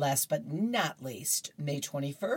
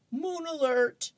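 moon alert sung out much higher and slower, drawn out; mock ominous; 11 semitones higher than usual. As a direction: stretch moon alert up high like a warning siren.

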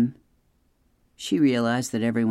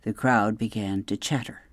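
usual speaking voice

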